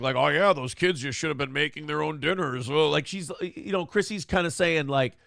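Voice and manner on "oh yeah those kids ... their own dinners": dry mock agreement; sarcastic. Host quoting a sarcastic guest, lightly sarcastic. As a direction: say it with a sarcastic edge. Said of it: "She's being sarcastic".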